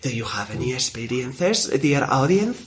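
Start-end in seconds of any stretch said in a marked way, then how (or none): none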